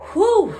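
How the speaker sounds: high, loud whistled whew; big shocked whew. Real, not acted. Reacting to Miguel's trigger.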